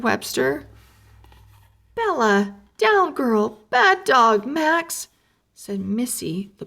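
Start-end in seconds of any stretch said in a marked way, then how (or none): 1.9-5.1 scolding dogs. A narrator's reading. Dog walker scolding leaping dogs.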